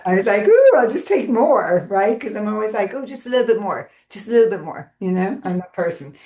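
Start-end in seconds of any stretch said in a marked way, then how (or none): 0.5-1.9 high, loud, drawn-out ooh of temptation; gleeful self-mocking overindulgence; eager indulgence. Acting out own greedy impulse. 2.9-4.9 coaxing self-indulgence. Retold or imitated. Mimicking own habit of excess.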